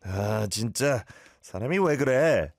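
annoyed "아, 진짜" and a rushed "사람이 왜 그래?"; exasperated demo. Shown as a demonstration. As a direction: show the caller how fed up sounds. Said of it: "That's more like it".

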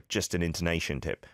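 ordinary delivery

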